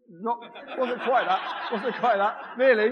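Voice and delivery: laughing through a correction; amused, laughing aside. Real, not acted. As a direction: chuckle while setting it right. Correcting volunteer's line, laughing.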